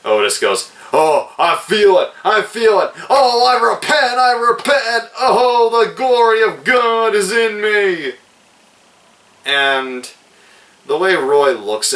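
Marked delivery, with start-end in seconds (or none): 0.9-10.1 mock a revival convert, cruelly. Reviewer imitates Otis mocking the dying man, high fake ecstasy. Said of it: "in a very cruel, mocking way".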